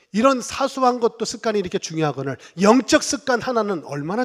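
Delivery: loud, high sermon delivery; full-force preaching. Real, not acted. Pastor preaching to the congregation, loud and driving. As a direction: preach it out to the pews.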